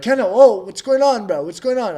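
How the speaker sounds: a teammate puzzled he's missing; worried teammates. Retold or imitated. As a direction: call after him, confused. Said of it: "And then they're like".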